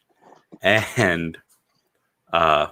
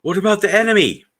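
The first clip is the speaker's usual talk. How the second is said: much higher, louder, fast worried soldier; high panicked soldier; 14 semitones higher, 8 dB louder, faster than usual. Retold or imitated. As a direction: blurt it out in alarm. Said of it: "and they're like".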